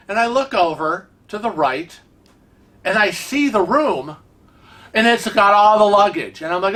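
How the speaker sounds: ordinary talk, nothing marked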